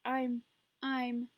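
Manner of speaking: high, loud, broken stammer; stammering sobs. A narrator's reading. Terrified girl stammers to her mother between sobs. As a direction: choke on the words through sobs. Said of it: "stammered between sobs".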